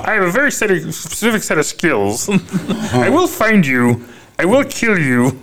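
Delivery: high puppet voice doing a tough-guy threat; Kermit doing Liam Neeson. Retold or imitated. Retelling a talk-show bit, Kermit doing Taken. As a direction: squeak out the threat like a frog puppet. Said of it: "Liam Neeson speech from Taken as Kermit".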